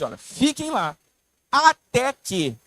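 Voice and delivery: até que drawn out slow and loud; stretched emphasis on até que. Acted. Preacher stretching até que for the congregation, slow and loud. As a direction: lean hard on até que, slowly. Preacher stresses the key words.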